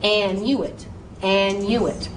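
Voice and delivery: and you it, with a stressed separate you; syllable by syllable. Shown as a demonstration. Teacher drills annuit by its syllables. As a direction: break it into an, you, it. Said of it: "say the U, say the I".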